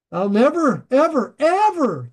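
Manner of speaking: never ever ever pushed up high and loud; emphatic never ever. Shown as a demonstration. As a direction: hammer each ever, rising. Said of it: "the Greek's very emphatic".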